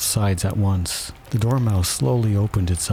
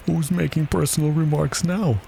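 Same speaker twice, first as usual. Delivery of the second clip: high-pitched gotcha question; triumphant; 9 semitones higher than usual. A narrator's reading. Hatter catching Alice out, triumphant. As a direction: score the point in a high voice. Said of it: "the Hatter asked triumphantly".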